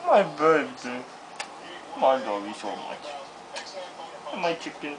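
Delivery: soft low cooing. Real, not acted. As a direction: murmur it lovingly to the pet. Cooing at a pet.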